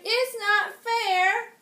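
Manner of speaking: high, slow, loud crying whine; wailing cry. Acted. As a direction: wail it like a child in a tantrum. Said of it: "cried the Grand Duke".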